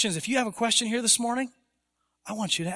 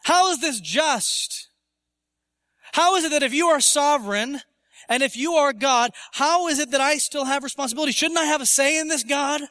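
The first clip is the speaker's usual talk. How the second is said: higher, indignant protest to God; rebellious questioning, voice raised in pitch; indignant challenge; 6 semitones higher, 3 dB louder than usual. Acted. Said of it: "resistant, indignant, rebellious questioning".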